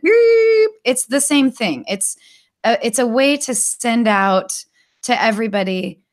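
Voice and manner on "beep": high, loud sustained beep; imitated alert tone. Imitating the TV test signal, high loud beep. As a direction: mimic the long test tone. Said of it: "when you're watching broadcast".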